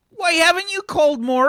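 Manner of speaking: high, loud nagging mum. Acted. Mum nagging Arthur for not calling, high and loud. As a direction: demand it like a hurt mother. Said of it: "She says".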